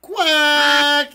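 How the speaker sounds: long, loud, high quack; belted duck call. Pretend. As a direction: let out a big drawn-out duck quack. Quack to start the race.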